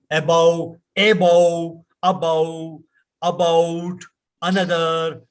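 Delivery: ABOVE, ABOUT, ANOTHER with full vowels; local pronunciation. Shown as a demonstration. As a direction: sound every syllable of ABOVE, ABOUT, ANOTHER evenly. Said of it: "we say".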